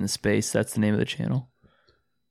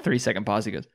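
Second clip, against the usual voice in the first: usual speaking voice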